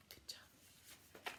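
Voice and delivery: soft breathy "Good job"; whispered praise. Real, not acted. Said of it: "whispered praise".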